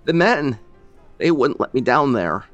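put-on high, loud, breaking old man's voice; words catching in his throat; faltering grief. Grieving old man breaks off mid-sentence, faltering.

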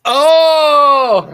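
sudden high shout of surprise; loud startled oh; shocked shout. Real, not acted. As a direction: yelp in disbelief. Reacting to the reveal.

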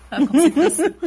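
laughing through the words. Real, not acted. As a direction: giggle while you say it. Distracted by chat, laughs.

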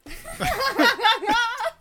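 high, loud staccato cackle; real helpless high laughter. Real, not acted. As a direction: burst into a long run of high ha-ha-ha. Laughing at own anecdote.

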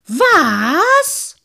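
high, very loud, drawn-out yell; explosive, indignant cry; outraged shout. A narrator's reading. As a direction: bellow in outrage. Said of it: "rief der Menschenmann aufgebracht".

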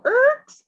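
high, loud imitated screech; sudden high squeal like brakes; screech to a halt. Asking friends about singing lyrics, sharp screech noise.

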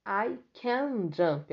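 "can" stressed, slow fall; slow model sentence. Shown as a demonstration. Teacher giving the written answer to students, slow. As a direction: dictate the answer. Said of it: "é o que deve ser escrito aí".

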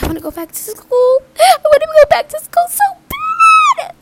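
high, loud whining; whiny plea. Retold or imitated. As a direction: beg like a wailing kid. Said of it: "I say".

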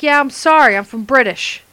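a hint of mock English accent; attempted English accent. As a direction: mimic someone trying an English accent. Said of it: "that English accent".

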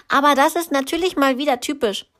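big sister mocking fast; snippy sister. A narrator's reading. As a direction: rattle off an annoyed complaint. Lynette complains about Lena.

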